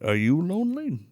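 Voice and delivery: a hint of a flirty Irish farmer; lightly voiced Sean Connery. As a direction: put on a light flirtatious brogue.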